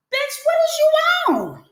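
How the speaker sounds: high, slow, drawn-out outraged holler; a big mock-outraged holler; outraged yell. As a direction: stretch it out in disbelief. Drink thrown in her face.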